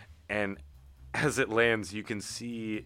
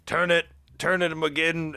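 higher, louder urging; urgent orders; 8 semitones higher, 4 dB louder than usual. Acted. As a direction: bark instructions from the floor. Geralt barking orders.

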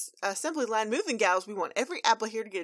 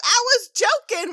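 alarmed shout; 11 dB louder than usual. Acted. Granny alarmed at West Orchard.

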